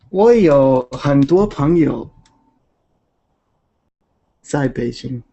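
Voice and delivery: non-native Mandarin accent; careful learner's Mandarin with a poor accent; terrible Mandarin accent. Real, not acted. Streamer says something nice to Beijing people, accented Mandarin. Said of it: "my accent's terrible".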